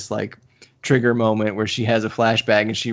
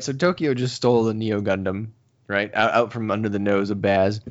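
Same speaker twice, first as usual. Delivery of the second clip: ordinary talk, nothing marked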